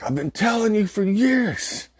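high, loud, slow outburst of told-you-so; mad vindicated yell. Retold or imitated. Boy to his mom after sister's fake cry, high indignant yell. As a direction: throw it at mom, finally proven right. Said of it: "I was so mad".

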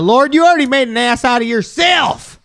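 high-pitched mock shout; playful yelled scolding; mock outburst. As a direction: holler an accusation, hammed-up. Joke outburst after mock amen.

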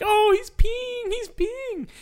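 put-on very high, slow childish squeal; kids' shocked squeal. Recalling classmates' reaction to teacher's prank, very high slow squeal. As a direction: gasp like startled fifth graders.

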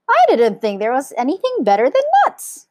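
high, loud monkey voice; surprised monkey. A narrator's reading. Monkey amazed anything beats nuts, high and surprised. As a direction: exclaim in high-pitched disbelief.

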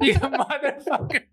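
high, laughing mock insult; laughing curse. Real, not acted. Laughing as he curses out his teasing co-host. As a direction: curse him out while cracking up.

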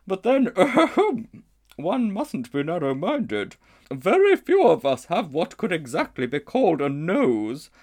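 a grand, ponderous lady elephant; she-elephant. A narrator's reading. She-elephant pondering the strange creature.

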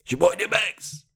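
rushed rapper impression in an altered voice; imitated rap shout-out. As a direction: throw on a quick rapper's intro voice. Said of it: "but D M X the same way".

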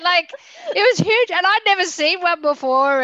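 high and loud, still amazed; excited retelling. Real, not acted. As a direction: relive the shock of the giant spider. Retelling giant spider in classroom.

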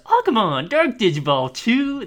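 high, loud, sassy announcement; sassy delivery. Retold or imitated. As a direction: say it with sass. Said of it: "that's all, like, sassy kind of".

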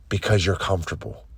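low, soft, quick drop; quiet verdict. Acted. Coach telling listeners why they lack answers, quiet verdict. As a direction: let the answer fall away, low and soft. Refrain dropped after each challenge.

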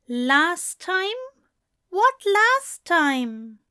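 can't believe it; puzzled alarm. A narrator's reading. Angel alarmed by the news.

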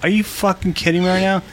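fast, high exasperated rant; explosive rage. Real, not acted. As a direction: rattle it off in disbelief. Fuming at the crackling sound.

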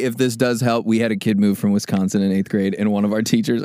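ordinary delivery

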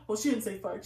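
usual speaking voice

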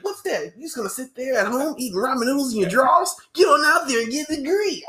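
high-pitched hammed-up scolding; imitated ad pitchman. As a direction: nag a couch viewer, rising to loud at the end. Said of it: "He was like".

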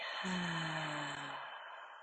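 long, slow sigh out on the breath; exhaling sigh. Shown as a demonstration. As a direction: let the breath go in an open "ah" sigh. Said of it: "exhale with a sigh".

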